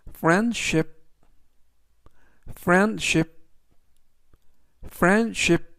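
"friendship" with the middle d barely sounded; pronunciation demo. Shown as a demonstration. Teacher showing students the weak d in "friendship". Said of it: "이라고 발음하시면 되겠고요".

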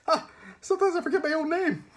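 very high, loud, hurried squeak; frantic high squeal; high-pitched wheeze. A narrator's reading. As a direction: wheeze it out at panicked full pitch. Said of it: "his voice was a high-pitched wheeze".

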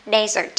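Desert with the first syllable stressed, DE-sert. Shown as a demonstration. Teacher models the noun Desert, first syllable stressed. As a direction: hit the first syllable of Desert, let the second fall. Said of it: "âm một nhá".

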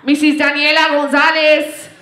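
calling out a patient's name, projected; waiting-room call. Acted. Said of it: "I was in a waiting room and I hear".

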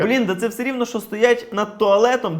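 normal talking voice

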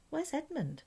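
put-on high, soft, worried little girl; a sudden anxious question; Lucy worried.

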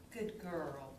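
low, soft praise. Real, not acted. Trainer softly praising her puppy.

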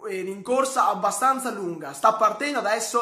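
ordinary talk, nothing marked

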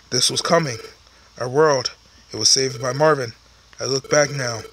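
low, soft narrator read; quiet wistful narration; story narration. Acted. Reads game narration lines.

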